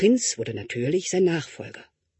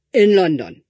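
mouth full; 4 semitones higher, 11 dB louder than usual. Acted. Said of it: "sagte dann mit vollem Mund".

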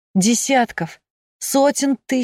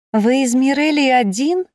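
higher, delighted question with a rising lilt; beaming; 6 semitones higher, 4 dB louder than usual. A narrator's reading. The double guesses where the visitor is from, beaming. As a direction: ask with beaming excitement. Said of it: "просияла моя копия".

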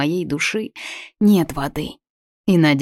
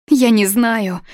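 breaking into a sob; 6 semitones higher, 5 dB louder, slower than usual. Acted. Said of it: "Голос срывается на всхлип".

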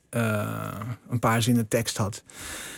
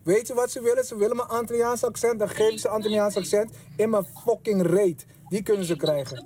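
furious rant; 8 semitones higher than usual. Acted. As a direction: explode at the agent. Film scene outburst, slipping into accent.

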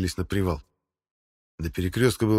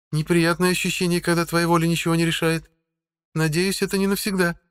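put-on higher, young woman's voice; female voice; 10 semitones higher than usual. A narrator's reading. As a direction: voice her as a woman. Sana and Helga speak.

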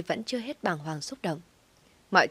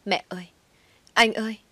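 understated alarmed call, rising on the second cry; narrator's restrained cry of alarm; panicked cry for help; 4 semitones higher, 4 dB louder than usual. A narrator's reading. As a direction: call out in shock for the family. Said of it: "Nghe tiếng gọi thất thanh của Nhài".

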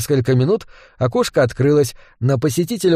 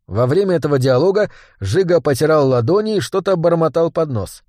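ordinary delivery, nothing marked

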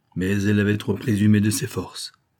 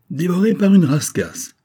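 high, loud mocking echo; amused mockery; 10 semitones higher, 8 dB louder than usual. A narrator's reading. Old sailor mocks the worried detective, loud and high. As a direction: laugh it back at him, high and loud. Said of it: "répétait le loup de mer".

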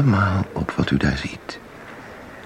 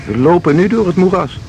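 raised, insistent guide's voice; urgent call; 7 semitones higher than usual. Acted. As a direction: call out over the moor, leading the way. Guide leading walk into marsh.

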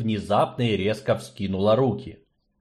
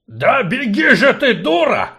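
loud, high furious bark; angry dwarf bark; 11 semitones higher, 7 dB louder than usual. Acted. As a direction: bark the insult at the stubborn girl. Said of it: "рявкнул Двалин".

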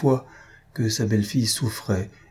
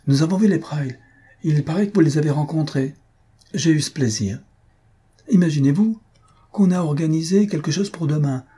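a bit higher, brighter male voice; chatty husband telling his wife the news, lightly voiced; 7 semitones higher than usual. Husband bragging to his wife of plans, a hint of eager chatter.

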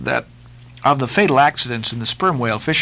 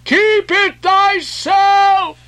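very high, long-drawn fanatical shout; shrieking prophet's refusal; crying out; 5 dB louder, much slower than usual. A narrator's reading. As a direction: wail the refusal high, stretching each word. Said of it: "cried Gabriel to Ahab".

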